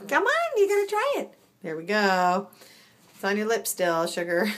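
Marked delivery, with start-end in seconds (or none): 0.0-1.3 very high pet voice. Real, not acted. Urging a kitten to try food, high coaxing.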